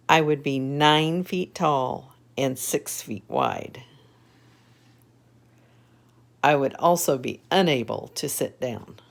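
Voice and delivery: lively storybook reading; reading to children. A narrator's reading. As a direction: read it brightly to little kids. Reads picture book aloud.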